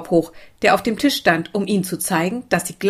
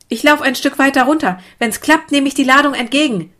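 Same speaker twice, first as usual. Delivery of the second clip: put-on raised, higher-pitched boyish call; 6 semitones higher, 4 dB louder than usual. A narrator's reading. Lukas to the others at the stream, calling out. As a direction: call your plan out eagerly. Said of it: "rief Lukas".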